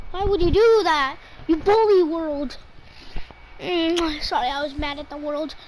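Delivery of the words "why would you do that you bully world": loud, whiny protest at a teammate; half-joking loud whine; loud complaint. Real, not acted. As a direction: yell it at the screen in mock outrage. Yelling at teammate's kill.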